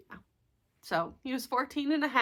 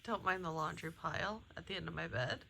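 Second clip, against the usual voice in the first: hushed; 2 semitones lower, 14 dB quieter than usual. Real, not acted. Quiet morning filming beside watching cat.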